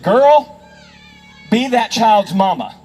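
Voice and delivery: imitated Southern matriarch, emphatic and slow; old Southern woman scolding. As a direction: put on an elderly Southern woman's reproof.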